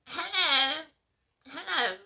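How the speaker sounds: high, nasal imitation of the woman; mock nasal hello. Woman in the street scene greeting Richard, high and nasal. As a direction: call out hello in a silly nasal voice. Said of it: "So the woman's going".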